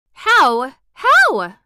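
high, loud, eager repeated question; eager cry. A narrator's reading. Mary cries out eagerly to the old gardener, high and loud. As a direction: burst out before she can stop herself. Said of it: "cried Mary, forgetting to be careful".

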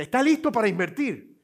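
high, loud, pushy question; imitating a telemarketer going for the close; hammed-up excited saleswoman; eager sales pitch. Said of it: "ella me dice".

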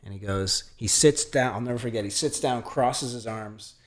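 ordinary talk, nothing marked

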